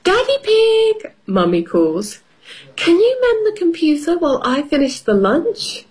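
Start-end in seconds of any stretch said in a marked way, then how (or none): 0.0-1.1 high call across the house. Acted. Mummy calls. 2.8-5.8 higher, firm mummy voice; mother voice. Acted.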